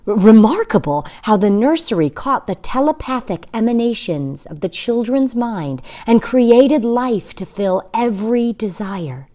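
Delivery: usual speaking voice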